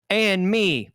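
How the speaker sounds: host hams up the movie line for Chloe; mock tough New Yorker. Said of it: "reenact this powerful moment".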